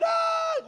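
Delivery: sudden high wailing cry; mock wail. Mourner wailing over the dead girl, high cry.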